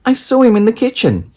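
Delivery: put-on high, fast little girl voice; insistent child. A narrator's reading. Daisy telling her parents, high and hurried. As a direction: rush it out like an eager child.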